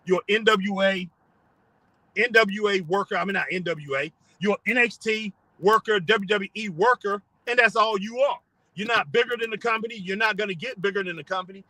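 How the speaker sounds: ordinary delivery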